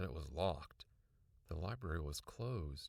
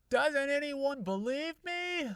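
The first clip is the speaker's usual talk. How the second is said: high, loud, slow wail; frustrated kid crying out that nobody believes her; 10 dB louder, slower than usual. A narrator's reading. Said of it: "I cried".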